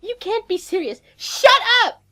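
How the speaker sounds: put-on angry girlfriend. A narrator's reading. Macy screeching at her captive, furious. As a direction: build to a screaming shut up. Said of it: "slaps me again and says".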